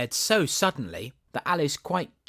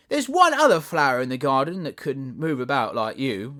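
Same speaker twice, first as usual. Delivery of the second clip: rose voice; 4 semitones higher, 7 dB louder than usual. Acted.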